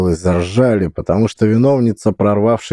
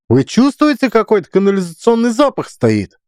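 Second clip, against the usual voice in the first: shrill director. Acted. Director voiced again.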